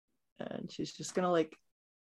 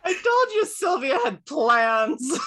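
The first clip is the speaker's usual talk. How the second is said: loud, slow, high gloat; drawn-out shout, cracking up; gleeful gloating; 11 dB louder than usual. Real, not acted. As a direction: crow it through laughter. Player gloats amid laughter.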